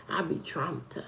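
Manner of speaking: breathy whispered aside; a hushed aside about being traumatized. Real, not acted. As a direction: drop to a whisper for the joke.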